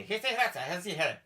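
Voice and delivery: mock Northern Irish lilt; Belfast accent. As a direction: imitate a Belfast local, lightly. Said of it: "a guy said to me".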